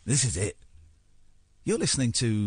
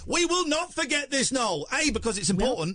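insistent; 10 semitones higher than usual. Real, not acted. Host insists again over the guest.

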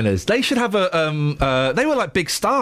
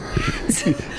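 falsetto laugh; 4 dB quieter than usual. Acted.

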